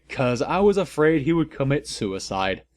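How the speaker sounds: ordinary delivery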